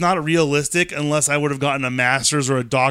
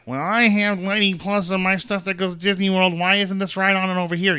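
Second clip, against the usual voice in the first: higher-pitched mock complaint; imitated complaining guest; 5 semitones higher than usual. Park guest asking why a ride isn't included, mock complaint. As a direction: put on the voice of a guest who doesn't understand. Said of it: "the people that don't understand".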